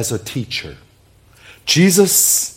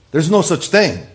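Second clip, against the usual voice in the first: higher, louder dismissal; scoffing disbelief; 6 semitones higher, 4 dB louder than usual. Acted. Unbeliever objecting to absolute truth, scoffing. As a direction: reject it outright like a doubter. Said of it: "if you're not a believer".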